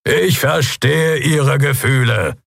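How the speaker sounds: high, slow, projected call; firm shout over a crowd. A narrator's reading. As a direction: address an angry crowd, drawing out the words. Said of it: "Das laute Organ des Kria-Hauptmanns".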